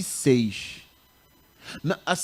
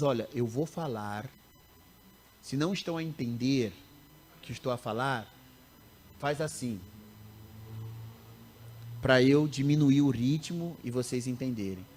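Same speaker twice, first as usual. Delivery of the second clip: Portuguese from Mozambique; 4 semitones lower, 5 dB quieter than usual. Retold or imitated. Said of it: "eu falava pros alunos".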